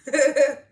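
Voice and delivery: a loud, high laugh bursting out; real burst of laughter. Real, not acted. Amused viewer laughing loudly at the show's joke.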